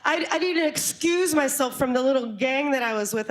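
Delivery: normal talking voice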